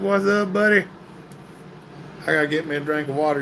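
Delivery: usual speaking voice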